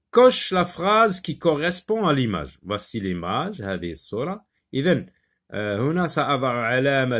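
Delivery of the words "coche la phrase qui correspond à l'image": loud, high, emphatic teacher read; reading out an instruction. Shown as a demonstration. Teacher gives pupils the task, read out loudly. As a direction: announce the exercise clearly to the class. Reads the exercise instruction aloud.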